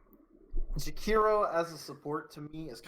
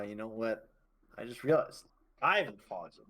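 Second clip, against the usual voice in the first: ordinary delivery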